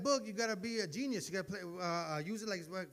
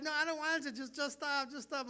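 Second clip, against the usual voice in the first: higher, whining refusal; mock whiny girlfriend; 7 semitones higher, 4 dB louder than usual. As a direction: whimper it like a half-hearted complaint.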